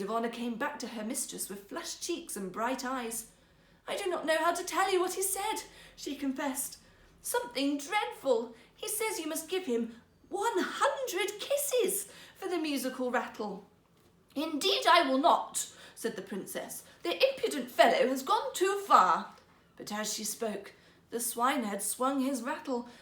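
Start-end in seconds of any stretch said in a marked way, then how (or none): 3.9-13.7 flustered maid. Acted. Flushed maid reporting. 14.4-19.3 loud, haughty princess voice; indignant refusal. Acted. Princess to her maids, loud haughty refusal.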